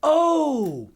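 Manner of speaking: high, slow, drawn-out concern; old lady realizing. Retold or imitated. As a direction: spell it out slowly to a child. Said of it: "she's like".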